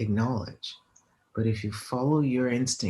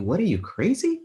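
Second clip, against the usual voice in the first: higher-pitched, incredulous questioning; incredulous objection; 6 semitones higher than usual. Retold or imitated. Everyone questioning her life choice, incredulous. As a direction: act out everyone's disbelief, voice lifting.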